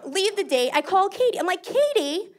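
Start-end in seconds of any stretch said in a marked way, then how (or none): 1.6-2.3 much higher, fast exasperated complaint; exasperated outburst. Retold or imitated. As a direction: call her out, outraged. Angry call to friend.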